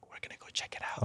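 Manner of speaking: whispered mischief, no voice; sneaky whisper from the naughty boys. Retold or imitated. Castmates sneaking a peek, whispered.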